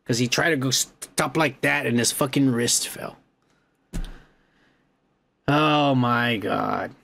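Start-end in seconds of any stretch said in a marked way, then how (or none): none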